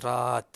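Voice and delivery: "rat" held as "raat"; long double A. Shown as a demonstration. Teacher explaining the double-A spelling, drawn-out "rat". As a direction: stretch the A in "rat" to double length. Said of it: "sepatutnya kita letak dua A".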